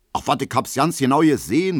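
high, loud Berlin interjection; soldier calling out. A narrator's reading. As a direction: call out as an eyewitness. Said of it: "ruft ein Soldat". (laut dazwischengerufen)

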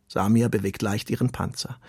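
plain reading voice

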